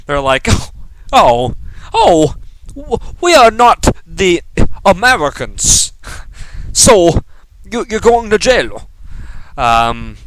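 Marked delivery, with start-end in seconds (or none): 0.5-8.9 put-on British voice slipping toward mock Chinese; botched British accent. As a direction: imitate stern Brits in a badly faked British accent. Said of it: "the British, they're like ... that's Chinese, by the way".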